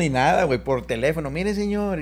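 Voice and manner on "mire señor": shy, timid high voice; sheepishly addressing the great singer; nervous little voice. Retold or imitated. Speaker imitating himself nervously calling [name], timid voice. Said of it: "con la vocecilla mía todo chiviado".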